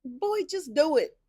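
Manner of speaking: high, loud exasperated urging; frustrated. Retold or imitated. Viewer urging Boyd to get on with it, frustrated. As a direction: plead at the screen, pitch way up. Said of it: "I was getting so frustrated with Boyd".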